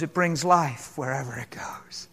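hushed wonder about the river; whisper. Real, not acted. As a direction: drop to an awed whisper. Preacher whispers the punchline.

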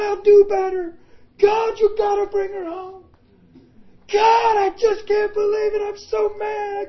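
high, loud anguished screams at God; desperate screaming. Retold or imitated. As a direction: scream your plea to God at full force. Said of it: "screaming loud".

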